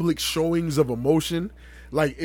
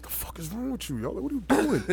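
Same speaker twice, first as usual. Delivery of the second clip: under the breath; 9 dB quieter than usual. Retold or imitated. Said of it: "You know when you type".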